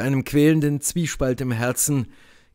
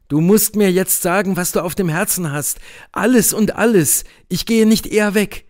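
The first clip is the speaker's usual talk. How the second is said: higher, louder, pressing demand; insistent young lover; 6 semitones higher, 4 dB louder than usual. A narrator's reading. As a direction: demand the whole truth, refusing to budge. (drängender Liebhaber, höher und lauter)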